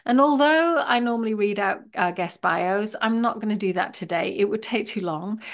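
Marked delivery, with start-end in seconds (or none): none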